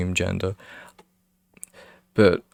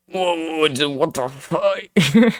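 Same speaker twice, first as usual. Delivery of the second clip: mock shocked; 7 semitones higher, much slower than usual. Reacting to "hey big boy" comment.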